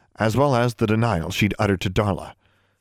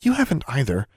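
plain reading voice

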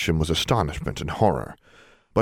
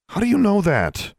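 much higher, sharply challenging question; argumentative; 4 dB louder than usual. A narrator's reading. Alexandra disputes the resistant elves, argumentative. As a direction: challenge them with a high-pitched demand. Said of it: "She was becoming argumentative".

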